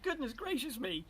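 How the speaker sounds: high-pitched storybook shock; startled exclamation. A narrator's reading. Mother looks into the water, high startled exclamation. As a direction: exclaim it high, taken aback. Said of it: "and exclaimed".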